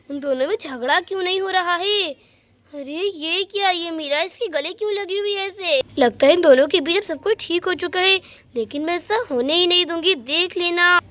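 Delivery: usual speaking voice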